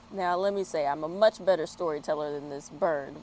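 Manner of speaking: usual speaking voice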